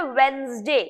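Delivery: वेडनेसडे said "WENZ-day", first d silent; correct Wednesday pronunciation. Shown as a demonstration. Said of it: "लेकिन pronunciation है".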